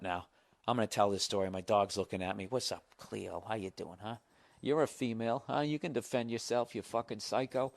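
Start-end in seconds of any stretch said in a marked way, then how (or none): 2.5-7.7 soft, low chat with a pet; quiet aside to dog. Real, not acted. Storyteller greeting his watching dog, quiet aside. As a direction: drop to a quiet, teasing murmur.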